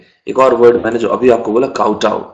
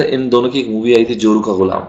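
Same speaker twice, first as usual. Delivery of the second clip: usual speaking voice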